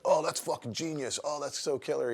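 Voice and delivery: high, loud imitation of a thrilled guy; stoked customer. Tattoo customer praising the artist's cover story, stoked. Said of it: "The dude was all stoked on it".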